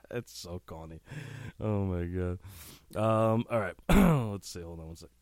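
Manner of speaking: usual speaking voice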